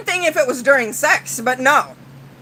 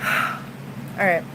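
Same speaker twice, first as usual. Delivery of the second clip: sighing calm-down; 7 semitones lower, 9 dB quieter than usual. Real, not acted. Calming down after rant.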